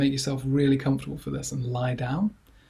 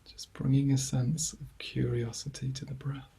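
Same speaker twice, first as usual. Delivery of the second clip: guided meditation; 5 dB quieter than usual. Acted. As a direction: ease listeners gently into calm, leaving long silences between phrases. Leads a body scan.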